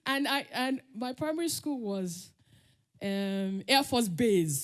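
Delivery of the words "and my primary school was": usual speaking voice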